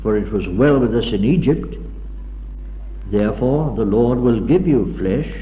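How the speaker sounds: ordinary talk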